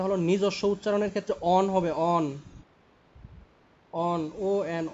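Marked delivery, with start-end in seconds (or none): 2.1-4.8 অন said alone, then spelled O-N; pronunciation of অন. Shown as a demonstration. Teacher gives the ketone's own suffix, sounded out. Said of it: "অন উচ্চারণ হবে".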